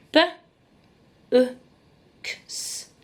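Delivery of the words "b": normal talking voice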